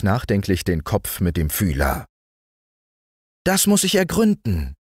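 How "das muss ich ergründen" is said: high, brisk ant voice; energetic ant. A narrator's reading. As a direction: say it briskly, all business, as the ant. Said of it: "sagte die Ameise energisch".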